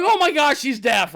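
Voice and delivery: high, loud burst of alarm; shocked realisation. Retold or imitated. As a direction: blurt it out in comic panic. Retold panicked realisation after screaming.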